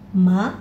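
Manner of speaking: ma rising; second tone ma. Shown as a demonstration. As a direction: lift ma upward. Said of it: "Dua, ini naik".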